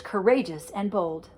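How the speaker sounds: ordinary delivery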